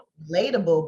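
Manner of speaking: usual speaking voice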